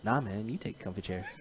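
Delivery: low, soft polite refusal; mellow, deep-voiced deferral; lightly imitating Justin. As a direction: wave it off quietly, down low. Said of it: "To which Justin's like".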